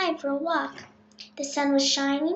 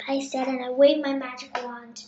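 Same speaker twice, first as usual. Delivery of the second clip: ordinary delivery, nothing marked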